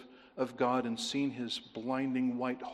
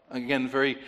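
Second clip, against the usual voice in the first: ordinary delivery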